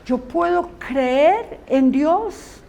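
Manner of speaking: normal talking voice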